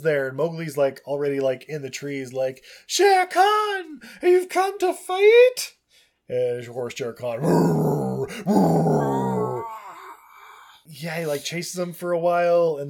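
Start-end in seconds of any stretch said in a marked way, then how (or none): 2.9-5.8 very high, loud shout; drawn-out, high battle cry; Mowgli's challenge. Acted. Mowgli challenges tiger from trees. 7.4-9.7 loud, rolled mock roar; rumbling imitation of a tiger. Imitates Shere Khan roaring.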